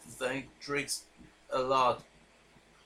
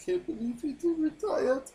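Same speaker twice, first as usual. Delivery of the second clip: high, breaking real sob; sobbing. Real, not acted. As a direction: cry the words out, voice cracking high. Host mourns a wrestler's retirement.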